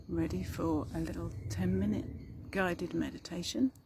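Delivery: ordinary talk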